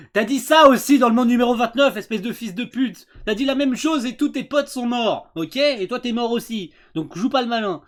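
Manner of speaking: loud furious scolding; angry rant. Real, not acted. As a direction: tell him off loudly. Angry retort at character.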